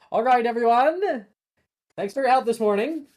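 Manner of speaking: high, loud cheerful professor greeting the class; booming cheerful teacher welcome; warm, enthusiastic Neville. Acted. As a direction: call out to the class with bright enthusiasm. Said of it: "his voice filled with usual warmth and enthusiasm".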